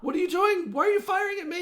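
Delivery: very high, loud alarmed cry; startled shout. Acted. Man in the trees shot at, crying out high and loud. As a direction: yelp out high in shock.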